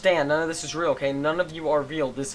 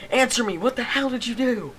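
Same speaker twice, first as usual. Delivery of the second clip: much higher, louder angry shout; furious demand; 11 semitones higher, 6 dB louder than usual. Acted. Hero yelling at Monica to confess. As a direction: bark the demand at her.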